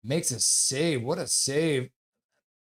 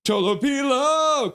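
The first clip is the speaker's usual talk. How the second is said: high, loud, slow name call; excited holler; cheering the goalie; 11 semitones higher, 5 dB louder, slower than usual. Real, not acted. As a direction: stretch the name like a crowd chant.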